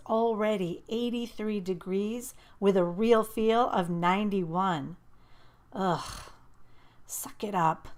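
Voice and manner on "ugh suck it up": inner scold to herself; disapproving thought. A narrator's reading. Scolding herself about the heat, disapproving. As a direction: tell yourself off. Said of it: "I thought disapprovingly".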